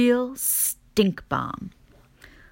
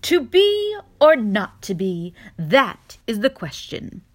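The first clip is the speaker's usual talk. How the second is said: loud, slow theatrical bellow, chest puffed out; showy bellow; 4 semitones higher, 8 dB louder than usual. A narrator's reading. Young possum shows off his acting to Mama, loud showy bellow. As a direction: declaim it like a proud stage actor. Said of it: "bellows in a loud and showy voice".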